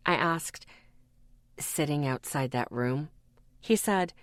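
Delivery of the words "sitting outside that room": drowsy low mumble; like he just woke up; half asleep. A narrator's reading. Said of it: "his voice still half asleep".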